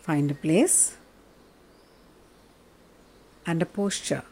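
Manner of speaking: guided meditation. Acted. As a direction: guide them gently into stillness.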